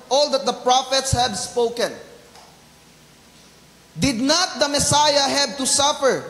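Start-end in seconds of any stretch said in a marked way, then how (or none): none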